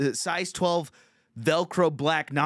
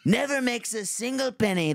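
Donald Trump; 10 semitones higher than usual. Retold or imitated. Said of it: "I start Trump heckling him".

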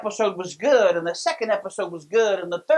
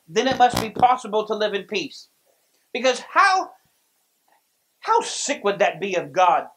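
normal talking voice

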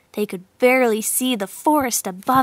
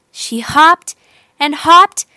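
building excitement; 4 semitones higher, 7 dB louder, slower than usual. A narrator's reading. Climax building.

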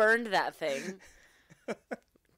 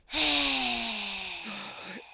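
long, noisy exhale; imitated heavy sigh. Daughter mimics dad getting up at night, heavy sigh. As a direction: mimic a heavy breather sighing. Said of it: "you get up and you go".

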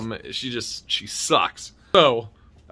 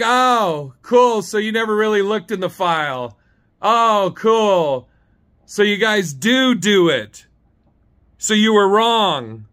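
loud, higher, drawn-out mock cool; pointed retold comeback; sarcastic gloating; 7 semitones higher, 6 dB louder, slower than usual. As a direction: rub it in, hitting each word. Rubbing the clerk's error in.

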